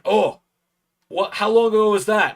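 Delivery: high, surprised wrestler impression; Joe Hendry. Wrestler reacts to a fan's sign, in a put-on voice. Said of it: "not the best Joe Hendry impression".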